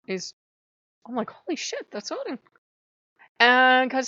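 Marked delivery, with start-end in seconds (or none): 1.3-2.4 much higher burst of surprise; stunned recognition. Retold or imitated. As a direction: gasp it out as it dawns on you. Recognizing Odin in the story.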